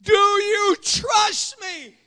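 high, very drawn-out heavenly call; voice from above. Acted. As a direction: intone it slowly as a voice from on high. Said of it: "And the voice says".

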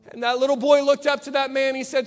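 ordinary delivery

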